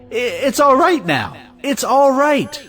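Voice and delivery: high, stammering relief; excited relief. Acted. Relieved after hearing salary doubled.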